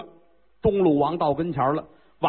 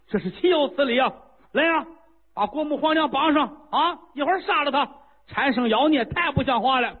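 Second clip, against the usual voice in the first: high, outraged royal shouting; a king beside himself, giving orders; furious king; 8 semitones higher than usual. Acted. King orders the queen bound.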